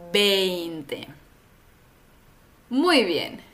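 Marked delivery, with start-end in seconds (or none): 0.1-1.1 veinte with a falling close, ei diphthong; model veinte. Shown as a demonstration. Teacher models veinte for students to repeat. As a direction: say veinte slowly for repetition. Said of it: "Repite después de mí".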